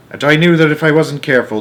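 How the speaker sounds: usual speaking voice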